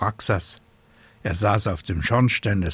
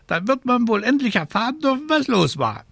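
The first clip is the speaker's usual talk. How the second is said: high, fussy scolding raven voice; 4 dB louder, faster than usual. A narrator's reading. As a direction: play a worried, scolding raven. Raven presses her.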